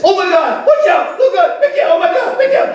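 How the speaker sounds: very high squealing mother in a flap; hammed-up falsetto alarm; mock panic. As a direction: imitate Mom's frantic shrieks, pitch shooting way up.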